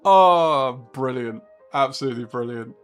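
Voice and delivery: usual speaking voice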